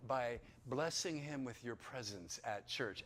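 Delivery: usual speaking voice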